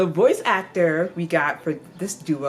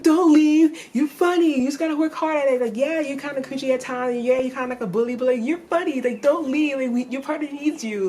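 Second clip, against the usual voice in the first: much higher and louder, a frantic plea; pleading don't leave; 10 semitones higher, 4 dB louder than usual. Pretend. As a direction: chase after him begging him to stay. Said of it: "be like".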